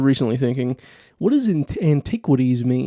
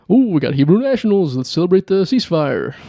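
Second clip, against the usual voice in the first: mock cheer; 5 semitones higher, 4 dB louder, faster than usual. Mock celebration voice.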